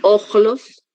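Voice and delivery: "Ojlos" with a raspy Spanish j; ch comme jota. Shown as a demonstration. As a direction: say "Ojlos", the middle like the j in "ajo". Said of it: "como un ajo".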